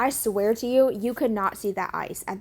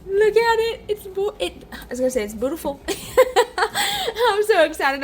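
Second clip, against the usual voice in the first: dog voice; 11 semitones higher than usual. Real, not acted. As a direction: coo like talking to a puppy. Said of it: "I'm talking in my dog voice".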